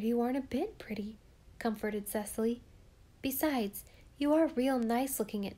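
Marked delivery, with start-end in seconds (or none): none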